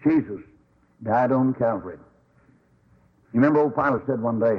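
ordinary talk

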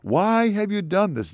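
high-pitched, drawn-out cry of protest; preacher voicing a panicked crowd's plea; mock panic. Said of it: "They panic".

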